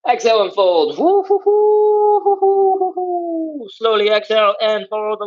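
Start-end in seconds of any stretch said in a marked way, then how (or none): 1.0-3.7 strained hooting. Real, not acted. Straining in a deep fold.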